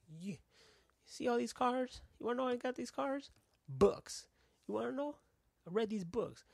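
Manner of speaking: high, loud salesman impression; YouTube pitchman. Guy in garage selling get-rich books, high pitchman voice. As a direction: pitch it up and punch it like an online ad. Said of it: "you know that one guy that shows up on your YouTube".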